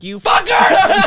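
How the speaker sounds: huge drawn-out high shout; emphasis on the end. Retold or imitated. Son imitating his mad dad, exploding into a shout at the end. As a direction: explode on the insult, stretched out. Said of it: "Emphasis on the end".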